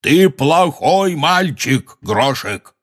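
slow, high, emphatic wail; scolding yell. A narrator's reading. Hagrid scolding the giant, yelling. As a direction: yell a scolding as at a naughty child. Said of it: "услыхали они вопль Хагрида".

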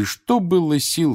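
usual speaking voice

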